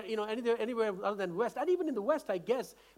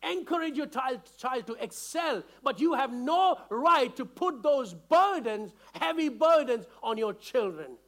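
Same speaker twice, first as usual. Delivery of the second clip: ordinary delivery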